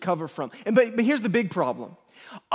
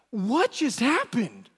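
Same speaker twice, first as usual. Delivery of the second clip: baffled questioning; 4 semitones higher, slower than usual. Retold or imitated. Wife questions him in story.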